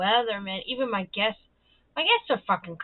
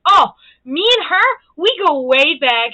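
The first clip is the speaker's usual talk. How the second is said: Bobby's bragging line, imitated a bit louder; imitating Bobby; 5 semitones higher, 9 dB louder than usual. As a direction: boast like the proud old regular. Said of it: "it's so funny how she says it to them".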